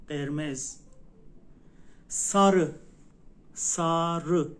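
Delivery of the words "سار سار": سار loud and drawn out as SAA-RI with dull ı ending; final dotless ı. Shown as a demonstration. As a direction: stretch sarı loudly, ending on ı. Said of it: "ببین آخرش اوه". («سار» بلند با «او» در آخر)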